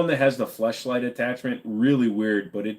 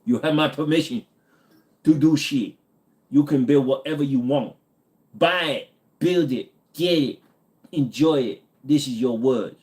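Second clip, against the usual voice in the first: higher-pitched mock Korean overlord decree; mock South Korean accent; 4 semitones higher than usual. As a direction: grant permission in a put-on Korean accent. Mock overlord grants permission in put-on accent.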